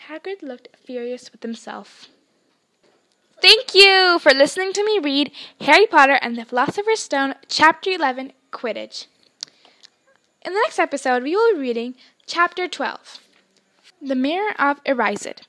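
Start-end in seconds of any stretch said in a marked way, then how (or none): none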